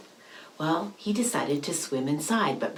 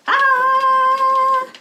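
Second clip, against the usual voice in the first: high loud shriek; scream; 11 dB louder than usual. Acted. Noise bursts from the shark's cave, shrill scream. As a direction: shriek out in sudden fright.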